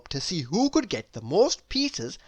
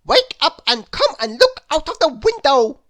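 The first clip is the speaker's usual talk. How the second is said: very high, loud wake-up call; Mister Silly crying out; 9 semitones higher, 8 dB louder than usual. A narrator's reading. Mister Silly waking his sleeping friend, loud high call. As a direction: shout him awake. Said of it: "cried Mister Silly".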